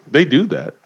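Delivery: a hint of a matter-of-fact old lady; lightly voiced heckler lady; lady in the corner. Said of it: "a lady in the corner going".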